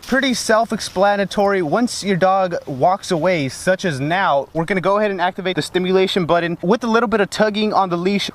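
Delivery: ordinary talk, nothing marked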